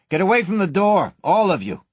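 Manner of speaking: short command. A narrator's reading. Jimmy ordering everyone from the vault, curt and loud. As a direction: clear them off the door. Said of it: "he commanded shortly".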